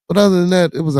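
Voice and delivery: loud switch back to the upside; sudden lift. Real, not acted. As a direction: jump the voice up. Sudden raised voice.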